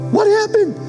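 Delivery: shocked high exclamation; surprised. Retold or imitated. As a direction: gasp it out in disbelief. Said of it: "My wife and I are like".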